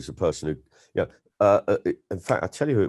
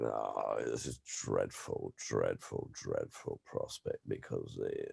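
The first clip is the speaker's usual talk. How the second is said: much lower and much softer, a languid upper-class drawl; Peter Cook impression; 11 semitones lower, 14 dB quieter, slower than usual. A comic doing a deep, hushed appalled voice for an interviewer. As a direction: sink the voice deep and soft, weary and appalled. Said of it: "Peter Cook just went".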